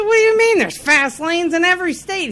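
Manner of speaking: high-pitched mock disbelief; indignant protest. Driver retelling arguing with policeman about fast lanes, indignant. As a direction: argue back incredulously, pitch jumping up.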